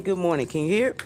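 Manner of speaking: usual speaking voice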